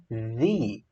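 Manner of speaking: "the" said thee, long I. Shown as a demonstration. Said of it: "puxa mais pro I".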